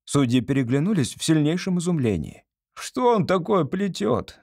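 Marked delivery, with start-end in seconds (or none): 2.8-4.4 high, slow, exasperated demand; barked question. A narrator's reading. Second judge baffled by the answer, barking. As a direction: snap it in baffled irritation. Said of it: "рявкнул второй судья".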